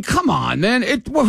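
ordinary talk, nothing marked

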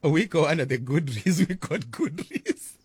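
laughing through the words. Real, not acted. Host laughs at mishearing gudri, laughing. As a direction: giggle over the mix-up.

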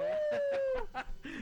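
high laugh. Real, not acted. Laughs at wolf remark.

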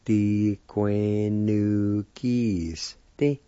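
Diquenuquiste stretched out very slowly, syllable by syllable; slow Diquenuquiste right down so learners can copy it; very slow demo. Shown as a demonstration. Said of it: "It's pronounced like this".

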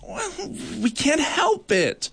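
very high, slow plea; beg, helpless; desperate. Acted. Bark beetle pleading with the judge, high and desperate. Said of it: "replied the desperate bark beetle".

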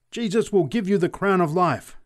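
high, loud exultant cry; exultant scream. A narrator's reading. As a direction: proclaim salvation in triumph. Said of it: "screamed the preacher exultantly".

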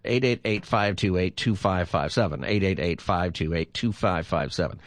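steady announcer read of the number; phone number read. Acted. Radio host giving listeners the call-in number.

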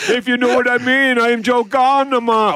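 mock Jamaican accent. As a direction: put on a playful Jamaican accent. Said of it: "Bit of [name]".

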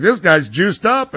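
slow it down and raise it like a scandalized fan; imitating accusers. Host voicing the crowd accusing a ballplayer, mock-accusing. Acting out public accusing Bonds.

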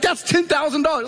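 high-pitched imitation of amazed disbelief; acted-out astonishment, pitch way up; stunned wife. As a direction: voice a wife shocked by the sum. Voicing stunned wife seeing check.

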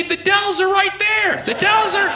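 ranting at an ex about the Dells; exasperated outburst. Acted. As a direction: hammer the Dells like it's obvious. Mock rant at ex.

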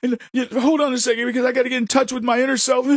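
high, fast, whiny mock voice; a fast, high parody of a fragile kid who got his feelings hurt. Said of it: "I didn't go".

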